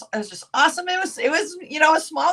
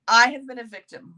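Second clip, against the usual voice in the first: ordinary talk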